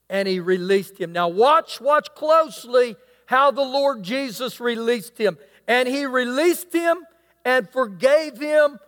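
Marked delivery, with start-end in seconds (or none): none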